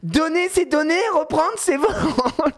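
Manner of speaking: high child chant, imitated; high, drawn-out put-on child voice; outraged little girl. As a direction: mimic a kid reciting the rule indignantly. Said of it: "Et là, lui dit".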